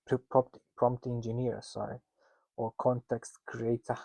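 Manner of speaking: ordinary talk, nothing marked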